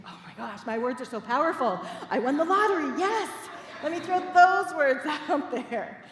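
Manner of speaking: laughing, loud and high with glee; excited. Retold or imitated. Minister recalling finding her ring, laughing excited gush. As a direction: gush like you won the lottery. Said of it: "It just gave me tingles 'cause it's like".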